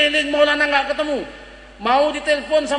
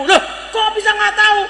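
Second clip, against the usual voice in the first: high, startled mother's question; 6 semitones higher than usual. Acted. Mother questions her son about the missed test, startled. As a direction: ask in raised-pitch disbelief.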